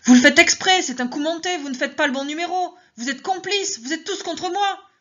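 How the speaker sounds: frantic, high-pitched accusing scream; screaming accusation. Acted. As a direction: shriek that they are all in on it. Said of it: "Je me suis mise à crier".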